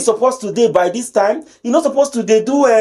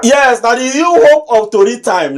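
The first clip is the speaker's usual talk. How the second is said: usual speaking voice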